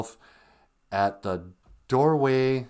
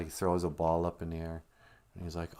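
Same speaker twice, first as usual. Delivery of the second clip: usual speaking voice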